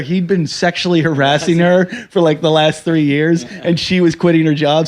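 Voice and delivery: ordinary talk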